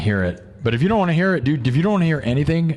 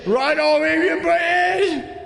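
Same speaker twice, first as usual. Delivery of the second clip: high, slow, drawn-out excited shout; rising to a high excited shout; hyped shout; slower than usual. Acted. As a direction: yell it out like a hype man. Hyping up going British.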